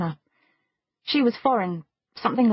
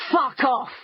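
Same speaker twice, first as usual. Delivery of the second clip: higher-pitched, hostile dismissal; threatening; 5 semitones higher than usual. A narrator's reading. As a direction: warn them off with menace. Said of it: "he said threateningly".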